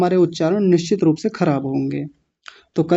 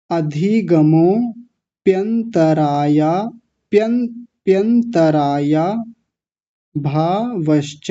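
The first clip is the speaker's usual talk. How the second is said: अधिगमोप्यंतराया drawn out, very slow; slow recitation; much slower than usual. Shown as a demonstration. Teacher recites the compound slowly for students. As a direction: recite it slowly, each syllable. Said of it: "ऐसे कर लीजिए".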